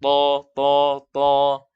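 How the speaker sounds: TO, TO, TO heavy, no aspiration; rounded TO repeated with the breath held back; tanpa angin mengalir. Shown as a demonstration. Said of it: "Tidak ada angin yang mengalir". (TO dibaca tebal, angin tertahan)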